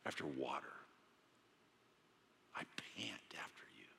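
fading into a whisper; whispered yearning psalm; breathless longing. Acted. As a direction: pant it out softly. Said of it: "David goes on to say".

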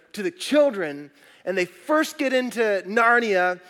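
usual speaking voice

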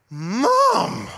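a loud high startled call of Mom!; shocked kid's cry. Retold or imitated. Daughter sees the offering envelope and cries out to her mother, loud and startled. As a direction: play the stunned daughter blurting it out loud.

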